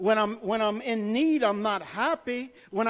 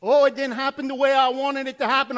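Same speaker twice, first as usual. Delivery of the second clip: loud, higher, whiny complaint to God; mock complaining believer; 7 semitones higher, 10 dB louder than usual. Complaining churchgoer whining loudly at God. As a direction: play the disappointed believer, loud and high. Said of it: "They're saying".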